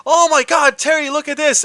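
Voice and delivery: high, loud startled cry; alarmed friend. Retold or imitated. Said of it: "he's like".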